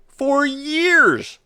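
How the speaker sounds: for years pulled high and long, years stretched; emphatic for years. Retold or imitated. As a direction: drag out "for years" in mock outrage. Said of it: "he argued".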